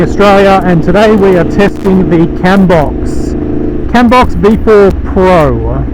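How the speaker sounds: muffled voice inside a motorcycle helmet; talking into a helmet mic while riding. Real, not acted. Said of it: "I've got the visor closed on the Ruroc Atlas".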